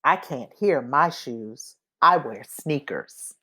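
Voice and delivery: usual speaking voice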